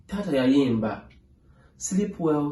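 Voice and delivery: ordinary delivery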